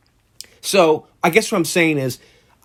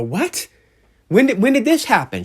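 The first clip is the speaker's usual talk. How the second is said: higher and faster, a retold jolt of surprise; shocked disbelief; 9 semitones higher than usual. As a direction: blurt it out as if just hit by bad news.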